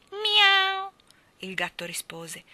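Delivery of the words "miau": high, long, loud meow; cat meow. A narrator's reading. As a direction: mew like a cat. Cat's meow. (miagolio acuto)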